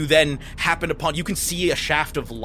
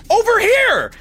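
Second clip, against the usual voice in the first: loud call from behind a door; shouting; 6 dB louder, slower than usual. Acted. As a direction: yell it out to the others. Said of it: "from the other side of the door, you hear".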